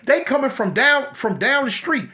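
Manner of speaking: ordinary talk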